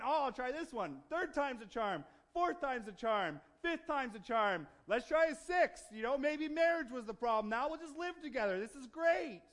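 hopeful and upbeat, pitched high and loud; mock optimism. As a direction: voice someone eagerly talking themselves into another try. Voicing the woman's ever-hopeful thinking.

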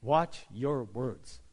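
Watch your words, said very slowly and low, each word drawn out; slow weighty maxim; slow, deliberate quote. Shown as a demonstration. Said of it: "I like this one".

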